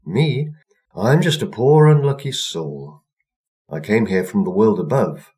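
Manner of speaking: a poor unlucky soul begging; poor girl. A narrator's reading. Girl tells the old woman her plight, high and pleading.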